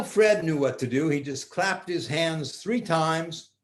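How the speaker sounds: plain reading voice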